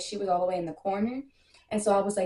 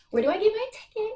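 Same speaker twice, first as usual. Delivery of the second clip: excited squeal; 12 semitones higher than usual. Real, not acted. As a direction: ask it bursting with excitement. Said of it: "I'm super excited".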